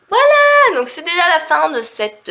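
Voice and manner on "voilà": very high, loud "voilà"; exclaimed flourish. Real, not acted. Vlogger closing the word list, exclaimed flourish.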